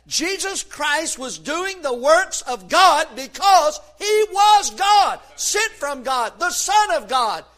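slow, loud, rising shout on he was God; preaching shout. Real, not acted. Preacher to congregation on who Jesus is, shouting. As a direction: hammer home each phrase.

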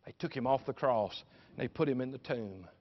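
normal talking voice

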